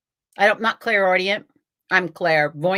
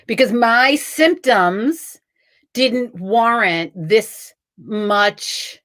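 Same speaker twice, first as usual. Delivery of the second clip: very slow, word-by-word delivery; incredulous emphasis; much slower than usual. Retold or imitated. Patient repeating why the hospital sent her away, incredulous. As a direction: spell it out slowly, pausing between phrases. Indignant recital of the dismissal reason.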